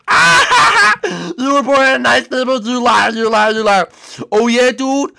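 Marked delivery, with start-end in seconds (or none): none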